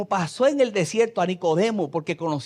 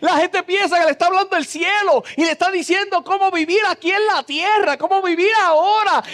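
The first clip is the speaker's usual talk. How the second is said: loud, high preaching at full force; fiery preaching; 7 semitones higher, 5 dB louder than usual. Acted. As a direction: preach it with fire to the congregation.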